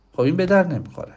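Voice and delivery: normal talking voice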